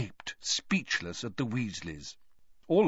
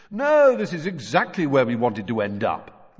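louder, heavily ironic; very sarcastic; 2 semitones higher, 6 dB louder than usual. A narrator's reading. Fred mocking Ron's question, loudly sarcastic. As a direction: exaggerate it with loud sarcasm. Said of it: "very sarcastically".

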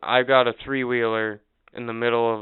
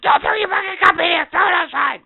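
much higher and louder mock yell; imitating a screaming cashier; 10 dB louder than usual. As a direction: shriek it like a furious cashier. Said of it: "screamed at me".

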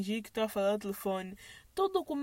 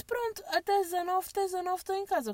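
fast, higher, breezy reply; quick brush-off; 6 semitones higher than usual. Retold or imitated. Texting the courier, a fast brush-off. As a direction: rattle it off to get rid of him.

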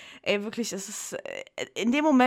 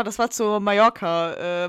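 ordinary talk

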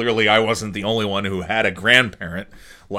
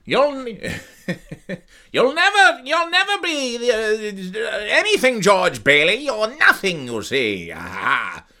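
much higher, slow hammed-up 1940s voice; old-movie villain; 10 semitones higher than usual. Shown as a demonstration. Vlogger showing how old films sounded, much higher villain voice. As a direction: ham it up like a forties movie heavy. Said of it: "when they talked like this".

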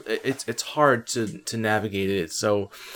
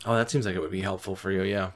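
usual speaking voice

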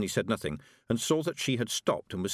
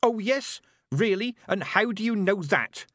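high, insistent demand; heated challenge; 12 semitones higher, 5 dB louder than usual. Acted. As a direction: fire the question back. Witch challenges screaming girl.

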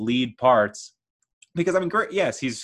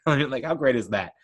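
ordinary delivery

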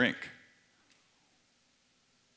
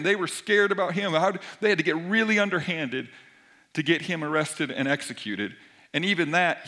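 louder, driving preacher build; preaching with force; 4 semitones higher, 6 dB louder, faster than usual. Acted. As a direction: push it home to the congregation. Preacher builds on Jesus' strength.

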